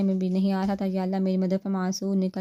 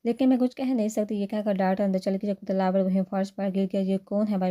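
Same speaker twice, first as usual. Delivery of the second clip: ordinary delivery, nothing marked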